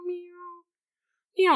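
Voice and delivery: tiny high, soft mewing; faint high-pitched kitten cries; little kitten meows. Retold or imitated. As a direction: mew like baby kittens. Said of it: "the little kittens are".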